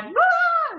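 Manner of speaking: sudden high shriek of surprise; startled scream. Retold or imitated. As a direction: let out a sharp startled squeal. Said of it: "I start freaking out".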